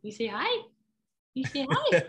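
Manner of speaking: high sing-song pet voice; talking to a dog. Real, not acted. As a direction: coax the dog to say hi. Getting dog to greet.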